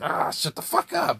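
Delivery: high exasperated yell at a noisy neighbour; angry yell. Retold or imitated. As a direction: snap it out, annoyed. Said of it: "You're like".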